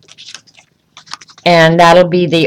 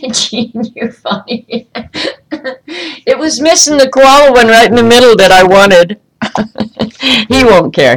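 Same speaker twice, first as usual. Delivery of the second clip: laughing; 5 semitones higher than usual. Real, not acted. Laughing at a viewer's comment.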